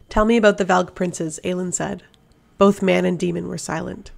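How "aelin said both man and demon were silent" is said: plain reading voice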